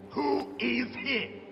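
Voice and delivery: slow, high-pitched interrogation demand; angry demand. Acted. Interrogator repeats demand to prisoner.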